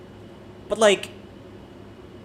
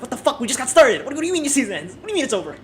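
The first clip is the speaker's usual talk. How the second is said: high-pitched, incredulous viewer outburst; indignant, pitched-up rant at a sudden ending; mock outraged disbelief; 7 semitones higher, 4 dB louder, faster than usual. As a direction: protest in baffled mock outrage. Acting a viewer's outraged reaction.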